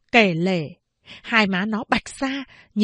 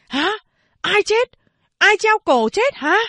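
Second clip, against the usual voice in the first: much higher, louder startled shouting; loud alarmed questions; 11 semitones higher, 5 dB louder than usual. Acted. Teachers running to the classroom, shouting alarmed questions. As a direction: call out in shock while running. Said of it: "Họ vừa hỏi to".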